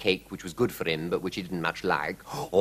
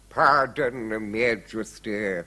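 slow, deferential servant; a hint of a humble butler; lightly voiced butler; much slower than usual. A narrator's reading. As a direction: apologise slowly to royalty.

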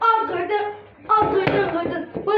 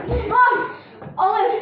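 ordinary talk

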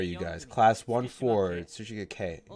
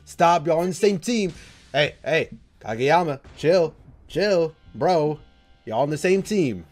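loud, raised-pitch pleading; alarmed calling out; 5 semitones higher, 6 dB louder, slower than usual. Real, not acted. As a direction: call out to break up a quarrel. Pleading with quarrelling anime teammates.

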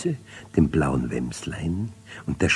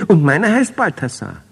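high, louder proud call; 11 semitones higher, 7 dB louder than usual. A narrator's reading. Twin Balthasar calls out his new puppy's name, high and loud. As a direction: shout the dog's name out with pride. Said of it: "rief Balthasar".

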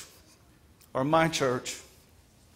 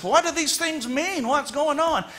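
put-on confused older son; 11 semitones higher, 3 dB louder than usual. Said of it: "turns to his servant and says".

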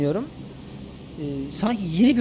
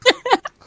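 burst of laughter; 5 dB louder than usual. Real, not acted. Lag mishap, sudden laugh.

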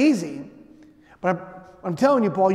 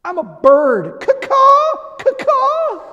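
very high, loud squawking bird caws with a crying laugh; child cawing loudly at her sleepy father; kid playing a bird; 11 dB louder, much slower than usual. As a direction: screech it out like a crow. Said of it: "she leans over me and she goes".

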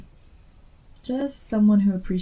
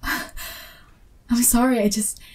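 normal talking voice

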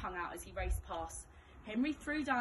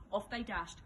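plain reading voice

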